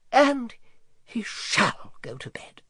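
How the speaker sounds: a touch of a startled dragon, whispered; dragon starting up. A narrator's reading. Dragon starts up and answers George, mildly startled. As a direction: jolt awake and agree. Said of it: "cried the dragon, starting up".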